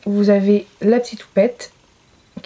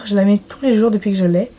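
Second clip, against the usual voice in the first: normal talking voice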